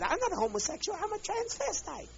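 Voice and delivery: high, mincing imitation of him; mock him in a high prissy voice; mocking falsetto. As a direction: falsetto parody of a man's excuse. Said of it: "He said".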